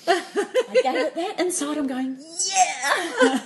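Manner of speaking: high, laughing through the words; giggling as she recalls teasing him. Real, not acted. Telling a friend about playing hard to get, laughing.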